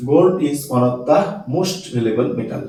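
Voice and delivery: each word spaced out very slowly for the class; spelling it out slowly for note-taking; slow dictation. Shown as a demonstration. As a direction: dictate slowly so students can write. Teacher dictates the sentence.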